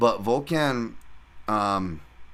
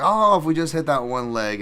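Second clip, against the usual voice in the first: mock whining bettor; 6 semitones higher, 6 dB louder than usual. Mocking bettors' near-miss excuse.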